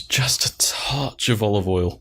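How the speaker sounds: slow mock-sommelier whisper. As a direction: breathe it like a wine snob.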